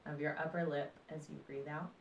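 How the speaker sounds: calm, unhurried meditation voice with long pauses; guided meditation. Acted. Meditation teacher guiding listeners through breath and body awareness, calm guided meditation.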